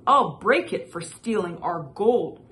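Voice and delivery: loud, threatening promise; angry vow. Acted. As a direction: swear revenge loudly. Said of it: "vowed Mister Li".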